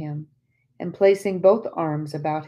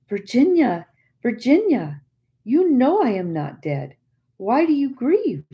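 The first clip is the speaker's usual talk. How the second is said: anguished plea; 7 semitones higher, 5 dB louder than usual. A narrator's reading. Spirit pleads with grieving friend.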